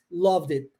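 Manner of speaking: "loved it" slow as one word, lovdit; linked d. Shown as a demonstration. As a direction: join the D to the I. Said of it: "Eu junto o D com o I". ("loved it" juntando o D com o I, devagar)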